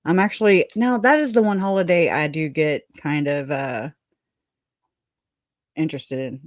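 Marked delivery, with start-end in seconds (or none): none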